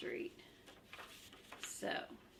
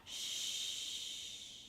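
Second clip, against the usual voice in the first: shushing. Acted. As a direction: hush the children conspiratorially. Grover hushing the reader.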